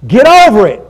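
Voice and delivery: short, loud, blunt command; blunt rebuke. Retold or imitated. Jesus telling the preacher bluntly, a loud flat command. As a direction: bark it like a no-nonsense rebuke. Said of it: "I'll say it exactly like He said it".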